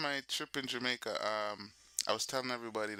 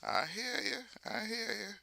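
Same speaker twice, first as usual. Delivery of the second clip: high-pitched self-mimicry; signature catchphrase; 9 semitones higher than usual. Shown as a demonstration. Streamer mimicking stuff they say on live, signature catchphrase. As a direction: pitch it up, repeating your own pet phrase. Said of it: "stuff that I say".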